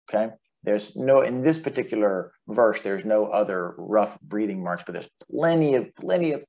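normal talking voice

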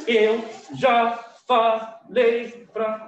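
very slow, loud, each syllable separated; syllable-by-syllable scolding. Retold or imitated. Teacher imitating a parent's scolding while hitting, syllable by syllable. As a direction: scold in beats, one syllable per blow.

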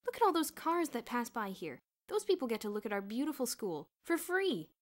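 animated and excited; sudden new life. A narrator's reading. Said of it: "said with sudden new life".

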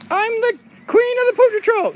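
high, insistent put-on voice; dog queen. Dog claims the patrol's throne, high and proud. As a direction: proclaim it as a high-pitched queen.

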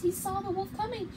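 ordinary delivery

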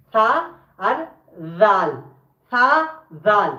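"সা" and "জাল" both lisped with tongue tip on the upper teeth; Arabic letter demo. Shown as a demonstration. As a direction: model "সা" beside "জাল", tongue at the tooth edges. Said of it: "এই দাঁতের সাথে লেগে উচ্চারণ হবে".

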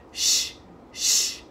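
two long, even shushes; shushing sound. Shown as a demonstration. Teacher giving a sound pattern to copy, long rhythmic shushes. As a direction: hush twice in rhythm.

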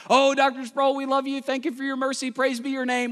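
high hammed-up adoration; mock students adoring the professor; fawning students. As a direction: gush over him like a hero. Students gushing praise.

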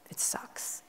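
unvoiced whisper of a blunt verdict; whispered aside to the boss at the launch. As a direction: breathe it like a secret. Retold secret chat with boss.

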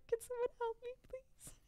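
tiny high voice, trailing away; mock meek plea. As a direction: imitate a helpless little plea, softening to almost nothing. Retold meek plea for help.

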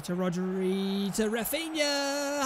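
ordinary talk, nothing marked

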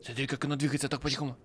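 fast hushed whisper; quick low whisper while sneaking; whispering while hiding. Real, not acted. Sneaking past enemy. (быстрый шёпот)

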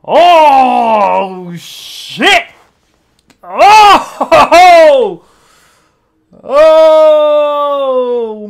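loud shocked yells of "Oh!"; excited shouting. Real, not acted. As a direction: shout in disbelief at the screen. Reactor shouting at shocking scene.

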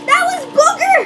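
disgusted squeal. Real, not acted. Reacts to booger bean.

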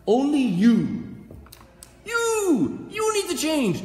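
loud, high, drawn-out accusation; emphatic accusing. Acted. Preacher tells listeners only they need to change, emphatic accusing. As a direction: call them out at full voice.

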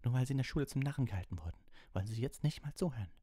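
high, heated protest; indignant accusation. A narrator's reading. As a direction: accuse the teacher in an angry rush. Harry angrily accuses Snape.

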